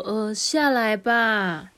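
lightly voiced storybook mom; coaxing mom. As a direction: coax the child down, stretching the words.

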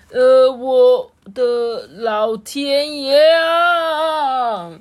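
high, very drawn-out wail; loud cry. A narrator's reading. Doctor atop an angry cow, loud dismayed holler. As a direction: holler slowly in comic dismay. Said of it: "布姆博士大叫".

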